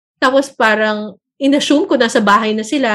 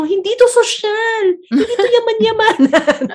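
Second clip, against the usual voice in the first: laughing denial; 10 semitones higher than usual. Retold or imitated. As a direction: deny it while cracking up. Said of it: "sabi ko". (natatawang pagtanggi, mabagal at mataas)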